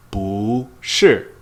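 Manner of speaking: 不是 with 不 rising as a second tone, 是 falling as a fourth tone. Shown as a demonstration. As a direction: model "不是" slowly: 不 climbs, 是 drops. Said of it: "it changes to second tone".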